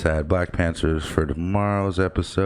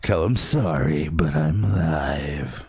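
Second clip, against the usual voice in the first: slow, stretched announcer-like delivery; playful put-on voice; 3 semitones lower, slower than usual. Host teasing co-host about texts, put-on announcer voice. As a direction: draw each phrase out theatrically. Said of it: "I just like doing that voice".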